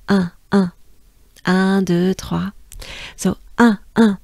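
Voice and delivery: nasal un, counted in French. Shown as a demonstration. Teacher drilling the nasal un in French numbers. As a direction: say un, deux, trois with a nasal un. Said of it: "the un sound".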